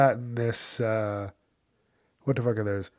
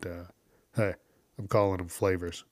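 ordinary delivery

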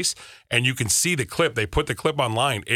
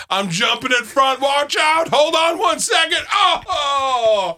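high, loud heroic cry; heroic shouting crow; 4 dB louder, slower than usual. Pretend. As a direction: shout like a brave hero bird. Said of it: "do you think the crows are gonna be like".

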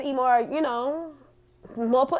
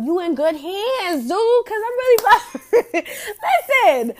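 higher and louder through laughter; laughing; 9 semitones higher, 7 dB louder, slower than usual. Real, not acted. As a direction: laugh as you reassure him. Laughing while promising loyalty.

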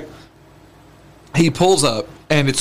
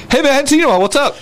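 friendly cop greeting; 8 semitones higher, faster than usual. Retold or imitated. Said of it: "He just walk up".

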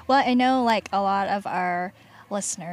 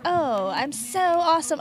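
higher, louder mock boast; hammed-up self-congratulation, pitched up; pretending to be guest; 6 semitones higher, 4 dB louder than usual. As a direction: play at bragging as someone else. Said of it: "I'm pretending to be you".

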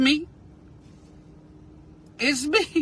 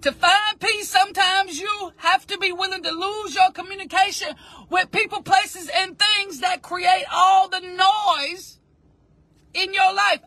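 lightly formal quotation delivery; reading the quote; 4 semitones higher than usual. Shown as a demonstration. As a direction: present the daily quote with weight. This is our quote for today.

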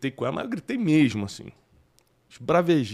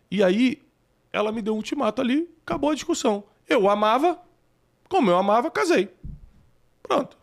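agitado; 10 semitones higher, 4 dB louder than usual. Real, not acted. Relato animado, voz sobe.

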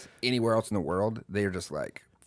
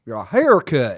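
loud, high mock-Japanese accent; Japanese inflection; 4 semitones higher, 10 dB louder, slower than usual. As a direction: imitate a Japanese speaker saying "haircut", loudly. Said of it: "the inflection of the culture you're in".

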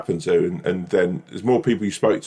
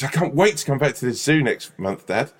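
put-on higher-pitched Famous Five child; kid's voice; 7 semitones higher than usual. Man mimicking his friend's child begging for the zoo, kid's voice. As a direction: put on an eager storybook kid. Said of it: "That's the kid's voice, like the Famous Five".

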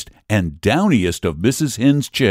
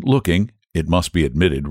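usual speaking voice

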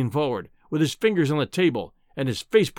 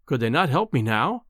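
ordinary delivery

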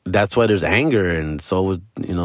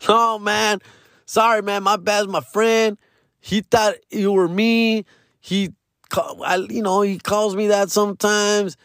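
higher, sheepish apology voice; smooth it over, pitched up; apologetic friend; 11 semitones higher than usual. Retold or imitated.

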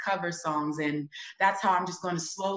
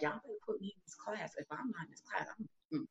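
determined mutter; 15 dB quieter, faster than usual. Retold or imitated. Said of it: "And I was like".

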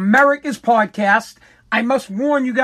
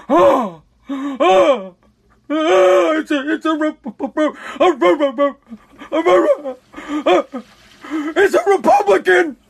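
horrified liberal; 5 semitones higher than usual. Retold or imitated. Mock liberal recoiling at a conservative, stammering in horror. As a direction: gasp and stumble like you can't say the word. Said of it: "They're like".

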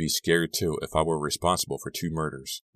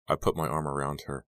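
ordinary delivery, nothing marked

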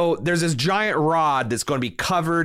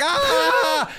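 high-pitched mock scream; imitated scream; 3 dB louder than usual. Friend reacting to battery acid in his eye, mock scream. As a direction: cry out in a high retold scream. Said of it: "starts shaking his hands and screaming".

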